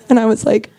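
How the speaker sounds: ordinary talk, nothing marked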